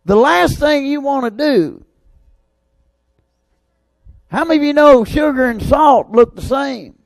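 high, loud preacher's build; fiery preaching. Real, not acted. Preacher firing up the church about sugar and salt, loud and high. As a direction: drive it home to the congregation.

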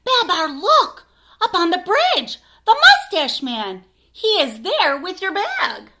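high, loud urgent call; excited shout. Acted. Zephyr spotting the thief on the bridge, shouting high and loud. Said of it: "Zephyr shouts".

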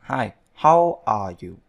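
hi, how are you drawn out slowly and loud; slow model reading. Shown as a demonstration. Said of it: "Thì bây giờ các bạn cứ nhớ đọc".

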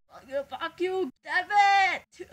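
frustrated outburst. Real, not acted. Cursing at the failed recording, a frustrated shout. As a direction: blurt it out, fed up.

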